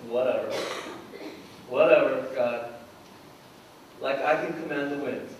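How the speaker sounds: low, dismissive sarcasm; sarcastic. Acted. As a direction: roll your eyes at God. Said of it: "a lot of sarcastic people in the Bible".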